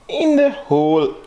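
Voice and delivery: high, drawn-out "In the hole"; playful high echo. Pretend. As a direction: stretch "In the hole" out, high and held. Playful repeat of the phrase.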